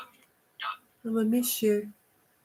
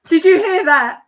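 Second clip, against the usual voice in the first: startled shout; 6 semitones higher, 14 dB louder, slower than usual. Real, not acted. Startled by voice from device.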